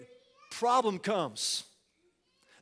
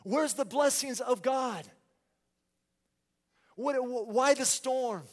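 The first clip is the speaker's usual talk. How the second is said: raised, louder anxious outcry; fearful doubting; 4 semitones higher, 4 dB louder than usual. Acted. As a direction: cry out to God as if abandoned. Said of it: "Would you just be consumed in fear".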